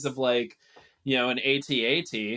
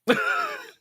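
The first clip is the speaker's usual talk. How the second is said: high squeal laugh. Real, not acted. Laughing at Chris Pratt joke.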